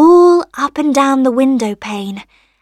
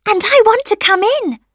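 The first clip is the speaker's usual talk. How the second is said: high, eager sunshine voice; cheery little voice; 11 semitones higher, 5 dB louder than usual. A narrator's reading. Sunshine asking rosebud to let it in, cheery. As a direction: ask cheerily in a small high voice. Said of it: "cheery voice".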